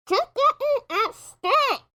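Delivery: slow, shouting through a stuffed mouth; muffled by a gag. Acted. Rainbow gagged with oven pads, muffled pleading. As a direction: yell around the pads in your mouth. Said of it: "pulled the oven pads from her friend's mouth".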